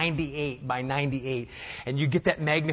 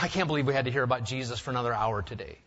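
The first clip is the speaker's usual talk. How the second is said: quick, softer grumble; mock complaint; 5 semitones lower, 5 dB quieter, much faster than usual. Preacher mimics a bored churchgoer, quick mock grumble. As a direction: toss off a mock bored whine. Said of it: "we wouldn't really say that".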